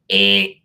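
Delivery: loud game-show wrong-answer buzz; buzzer noise. Pretend. Rejecting self-blame like a game show, loud buzzer noise. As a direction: blast it like a quiz-show buzzer. Incorrect response.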